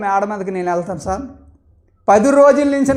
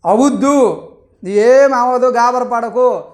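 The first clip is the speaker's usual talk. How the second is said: emphatic reassurance; 6 dB louder, slower than usual. Retold or imitated. Reassuring worried wife emphatically.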